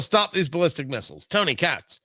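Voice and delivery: usual speaking voice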